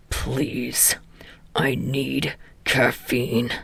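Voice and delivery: low, slow tired growl. A narrator's reading. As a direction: growl the plea out. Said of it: "Katsuki growled".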